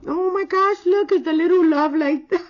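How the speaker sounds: high, loud gushing over a cute charm; delighted squeal. Real, not acted. Nail artist gushing over the cute charm, high delighted squeal. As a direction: squeal with delight, voice cracking with a laugh.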